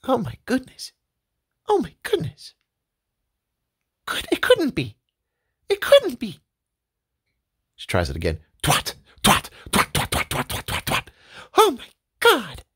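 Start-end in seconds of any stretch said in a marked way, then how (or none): 0.0-6.4 high, astonished wife voice; amazed wife. Acted. 8.6-11.1 fading swatter noises; repeated swatter smacks. Acted. Testing the swatter. 11.6-12.6 high, astonished wife voice; amazed wife. Acted.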